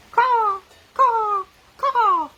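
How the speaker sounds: high, loud cawing like a crow. Acted. Reading the crow's part to children, loud high caws.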